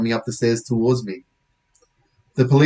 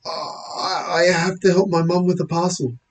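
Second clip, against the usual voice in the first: higher, halting reply; nervous excuse; 6 semitones higher than usual. Acted. Boy excusing himself to policeman, nervous. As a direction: falter on the start, voice up.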